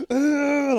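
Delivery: high loud excited squeal of delight; daughter's happy squeal. Retold or imitated. Daughter on candy, high loud squeal. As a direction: squeal like a giddy little girl. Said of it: "like that".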